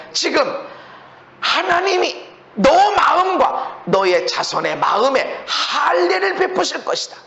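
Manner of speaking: ordinary talk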